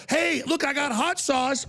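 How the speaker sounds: high, bright hammed-up greeting; mock pandering guest. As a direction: play the clueless visitor showing off hot sauce. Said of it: "and I go".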